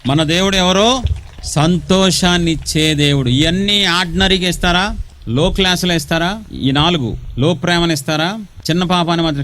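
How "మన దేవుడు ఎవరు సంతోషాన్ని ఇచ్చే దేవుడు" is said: slow, raised, drawn-out call to the crowd; preaching call and response. Acted. Preacher rallying the congregation to clap, slow and lifted. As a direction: ask the church and answer slowly, lifting each line.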